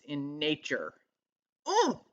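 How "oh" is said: sudden high-pitched "oh"; startled oh. Real, not acted. Speaker feeling the energy mid-explanation, startled high oh. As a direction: jolt out a high little cry.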